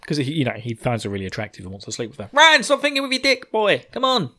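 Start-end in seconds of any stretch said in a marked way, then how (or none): 2.3-4.3 high-pitched exasperated telling-off; playful, exasperated nagging; mock scolding. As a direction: plead with a daft mate to see sense. Scolds the character directly.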